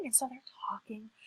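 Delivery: usual speaking voice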